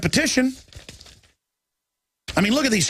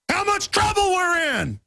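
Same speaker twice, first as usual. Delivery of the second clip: alarmed shout; 12 semitones higher than usual. Real, not acted. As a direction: sound the alarm to every listener. Host alarmed about gun crackdown.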